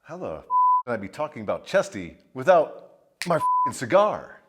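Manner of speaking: ordinary delivery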